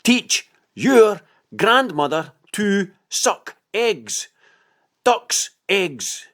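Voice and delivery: high, slow, each word sinking in; slow and clear. A narrator's reading. Anna answering Simeon across the rocks, high and slow. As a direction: lay each word down slowly so it lands. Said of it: "spoken slowly to let each word sink well in".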